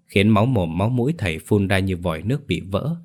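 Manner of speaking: ordinary delivery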